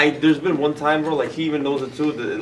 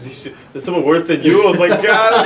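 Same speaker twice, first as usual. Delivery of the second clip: high, loud, amused disbelief; playful shock, getting higher and louder; mock shocked reaction; 6 semitones higher, 9 dB louder than usual. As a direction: act out alarmed disbelief, building into laughter. Retelling his shocked reaction, laughing.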